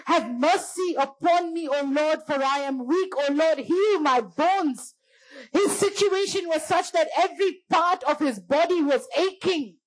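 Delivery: high-pitched, pleading preacher's delivery; impassioned preaching. Acted. Preacher pleading David's psalm to the church, impassioned preaching. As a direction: preach it high, drawing out the line ends.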